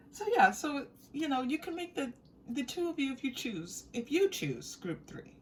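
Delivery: ordinary talk, nothing marked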